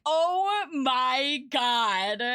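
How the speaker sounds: slow, stretched-out oh my God; drawn-out amazed gasp. Retold or imitated. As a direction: let the amazement drag out. Reacting to grandma cardinal news.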